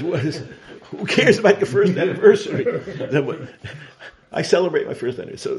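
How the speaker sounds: high and loud, laughing as the words come; laughing through the punchline. Real, not acted. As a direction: crack up while delivering the joke.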